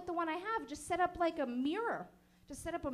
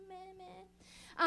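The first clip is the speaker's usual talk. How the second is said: small higher-pitched bleat; imitated heckler noise; 4 semitones higher, 4 dB quieter than usual. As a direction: mimic someone's dismissive little meh. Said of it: "then someone went".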